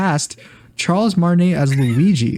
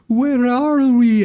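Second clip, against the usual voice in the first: slow, high hammed-up Mario; 9 semitones higher, much slower than usual. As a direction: drawl it wide-eyed like a lost plumber. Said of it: "Mario being like".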